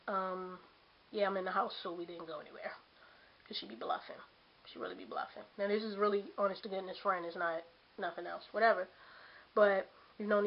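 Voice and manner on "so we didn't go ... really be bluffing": very soft, nearly whispered; hushed aside. Real, not acted. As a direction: drop to a hushed, confiding aside. Confiding aside about the friend.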